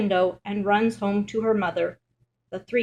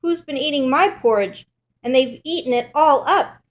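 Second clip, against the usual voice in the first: higher, louder little bear voice; upset bear cub, pitched up and indignant; Baby Bear; 6 semitones higher, 6 dB louder than usual. A narrator's reading. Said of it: "Baby Bear looks at his bowl".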